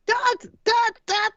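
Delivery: high, urgent calls for dad; calling out. Acted. Young Cyril calling out for his father in the basement. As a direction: call for your father down the stairs. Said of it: "calling out for his father".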